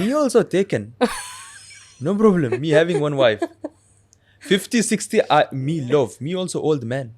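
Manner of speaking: put-on broken-English father character accent; lightly voiced old father with a heavy accent; Abu Zubair accent. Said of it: "He has an accent".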